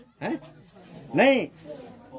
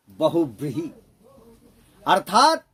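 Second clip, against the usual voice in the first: ordinary delivery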